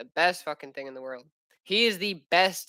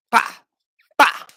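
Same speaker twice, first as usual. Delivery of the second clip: short, explosive "bah" bursts; punching noises; 8 dB louder than usual. Pretend. Dad play-sparring with his boy, punching noises. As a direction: punch out each sound sharply.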